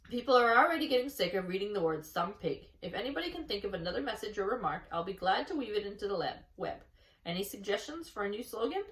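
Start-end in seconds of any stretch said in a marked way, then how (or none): none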